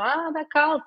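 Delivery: put-on high, loud whiny child voice; child's whine. Mother imitating her son crying out in the snow, whiny child. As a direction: whine it like a small boy in snow.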